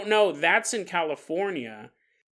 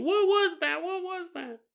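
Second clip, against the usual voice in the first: panicked; 11 semitones higher than usual. Retold or imitated. Students screaming across campus.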